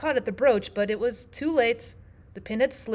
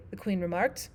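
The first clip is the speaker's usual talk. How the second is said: usual speaking voice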